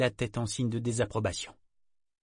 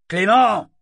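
bellowed, much higher and louder; bawling a name; 11 semitones higher, 14 dB louder than usual. Acted. Captain bawling for the young sailor, loud yell. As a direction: yell across the deck. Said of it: "brailla-t-il".

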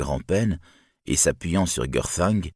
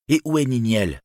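higher, pressing question; urgent demand; 6 semitones higher, 3 dB louder than usual. A narrator's reading. Turin asking the silent crowd about Niniel, demanding. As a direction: demand an answer from them.

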